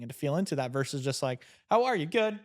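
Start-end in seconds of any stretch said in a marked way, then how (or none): 1.7-2.4 quick exchange, pitched up and louder; automatic small talk. Acted. Acting out a greeting without thinking, perky and automatic. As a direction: toss off a reflex greeting and reply.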